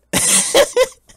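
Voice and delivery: a loud, airy burst of laughter he can't hold in; breathless helpless laugh. Real, not acted. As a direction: crack up despite trying to stay serious. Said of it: "I'm crying and it sounds like I'm laughing".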